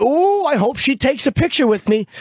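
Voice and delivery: high, gushing mock excitement; mock eager fan. Man imagining fawning over an old lady, mock eager. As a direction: coo with put-on fannish hope.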